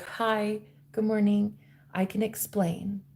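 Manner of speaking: mimicked meek apology; sheepish. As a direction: explain yourself very quietly. Acted intruder excusing himself.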